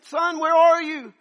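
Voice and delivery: high, loud, slow call; father screaming for his son, loud and slow. Retold or imitated. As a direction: yell it across the house for your boy. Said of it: "I'm screaming for Aaron".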